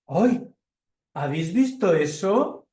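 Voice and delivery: a touch high and loud, startled little bear; hint of a startled cub. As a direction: gasp it out like a surprised bear cub. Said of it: "Esto le está diciendo la osita".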